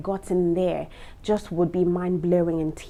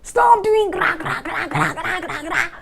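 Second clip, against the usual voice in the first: higher, louder, squawking gra gra of a row; mock quarrel noise; 10 semitones higher, 5 dB louder than usual. Mocking women who squabble, squawking gra gra. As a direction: mimic a squabbling wife's angry noises. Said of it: "Even when you're quarreling".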